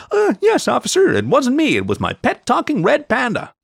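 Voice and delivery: very high-pitched mock-innocent voice; squeaky, sarcastic play-acting; sarcastic mock confession. A narrator's reading. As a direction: act out a ridiculous excuse to police. Imagined sarcastic reply to officer.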